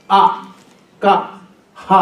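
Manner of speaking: అ, క, హ each said alone from the throat; throat sounds. Shown as a demonstration. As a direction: isolate each guttural, అ, క, హ, with pauses. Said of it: "ఇక్కడ కంఠం నుంచి పలకాలి".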